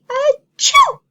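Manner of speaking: very high loud acted achoo; big cartoon sneeze for the kids; huge sneeze. Acting the sneeze again.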